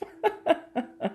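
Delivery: high-pitched helpless laughter; real high giggling. Real, not acted. Cracking up at the gif.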